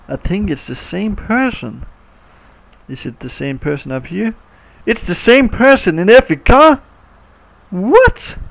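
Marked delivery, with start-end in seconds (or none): 4.9-8.4 high, loud astonished question; amazed disbelief. Real, not acted. Kid notices every car has the same driver, astonished. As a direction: shoot the pitch up in disbelief.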